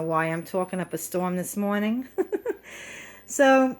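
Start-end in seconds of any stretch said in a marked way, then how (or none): 2.1-3.0 breathy laugh. Real, not acted. Laughs at own chatter.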